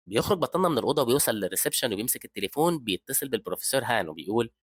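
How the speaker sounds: usual speaking voice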